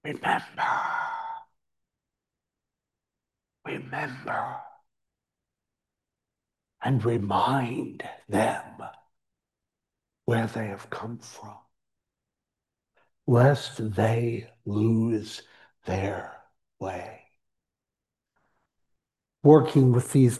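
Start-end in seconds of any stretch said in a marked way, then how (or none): 0.0-4.6 breathy, hushed "Remember. Remember."; whispered. Acted. Hushed repeated command. 6.8-17.2 soft, slow, fading to nothing; hushed fading. Acted. Closing scripture words fading.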